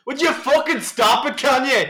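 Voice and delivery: high, loud, slow scolding mam; scolding parent. Acted. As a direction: yell at the kid like a mother.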